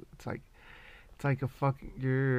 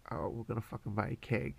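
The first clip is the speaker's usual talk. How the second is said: usual speaking voice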